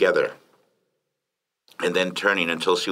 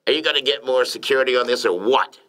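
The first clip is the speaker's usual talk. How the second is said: higher, exasperated order; impatient demand; 6 semitones higher than usual. A narrator's reading. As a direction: press your security chief for action. Boss demands action from security chief.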